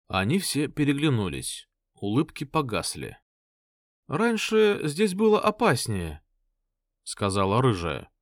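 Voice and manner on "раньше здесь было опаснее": sober high woman's voice. Acted. Redhead, serious and high.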